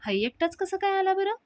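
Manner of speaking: mock his coming alone; sneering question. Sulakshana sneering to the guests about Raja, high and sarcastic.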